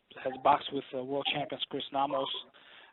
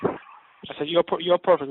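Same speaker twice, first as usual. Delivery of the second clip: slow, louder, spelling it out; louder repeat for a bad line; 3 semitones higher, 8 dB louder, slower than usual. Real, not acted. Host repeating to a caller who can't hear, slower and louder. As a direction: push the words down a poor phone line.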